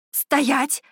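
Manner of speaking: shouted command. A narrator's reading. Heroine halting the warriors with a shout. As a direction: stop them with one cry. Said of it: "закричала я".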